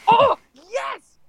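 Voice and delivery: high, loud joyful yelp; excited cheer. Real, not acted. Player cheers her dice roll loudly. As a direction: whoop at the great roll.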